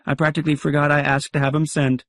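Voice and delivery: fast, high, offhand; feigned casual. Acted. Narrator faking indifference to his wife, fast and offhand. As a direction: rattle it off as if it's nothing.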